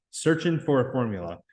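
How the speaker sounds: ordinary delivery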